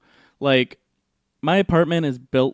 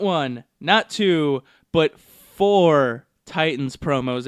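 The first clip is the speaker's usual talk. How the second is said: each number stretched out slowly; slow emphatic count; 3 dB louder, much slower than usual. Real, not acted. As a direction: draw out the count for effect. Building up the count.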